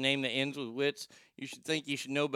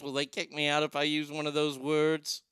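usual speaking voice

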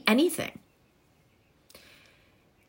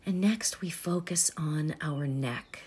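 soft spa whisper; 2 semitones lower, 8 dB quieter than usual. Acted. Leads a body breathing meditation.

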